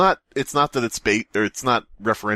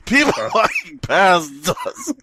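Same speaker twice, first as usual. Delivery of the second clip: high, slow, louder announcement; laughing mock finality; mock-dramatic ending; 6 semitones higher, 3 dB louder, slower than usual. Real, not acted. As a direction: pronounce the finale with fake gravity. Mocking the story's ending.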